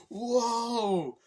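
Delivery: high, loud, drawn-out whoa; mock cheering holler; imitated crowd cheer. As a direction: whoop like an impressed crowd.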